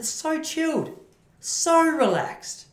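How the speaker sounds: high, slow dreamy sigh. Pretend. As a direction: drift the words out blissfully. Dreamy praise of relaxed surfers.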